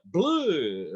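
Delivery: hammy Dracula-style "bleh"; vampire bleh. Shown as a demonstration. Teacher adding a vampire flourish, goofy bleh. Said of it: "you can always do the".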